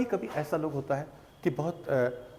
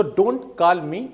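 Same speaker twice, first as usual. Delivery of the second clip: very slow and louder retort; slow, louder teaching demo; slow firm demo; 3 semitones higher, 8 dB louder, much slower than usual. Shown as a demonstration. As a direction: stretch it out firmly. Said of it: "जो उसने कहा".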